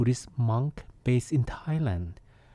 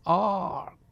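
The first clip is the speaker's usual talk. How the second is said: mild, drawn-out cry of pain; high, restrained storybook scream; mock scream; 6 semitones higher than usual. A narrator's reading. Boy falls on the mountain and hurts himself, mild mock scream. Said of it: "hurts himself and screams".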